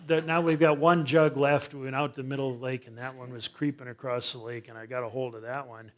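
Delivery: normal talking voice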